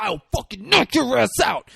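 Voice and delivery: hammed-up furious outburst; acted angry threat. Retold threat during the fight.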